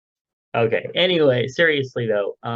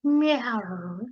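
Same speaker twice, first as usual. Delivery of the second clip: high, playful cat meow; pirate cat meow; 10 semitones higher than usual. Pretend. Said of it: "Meow Like a Pirate Day".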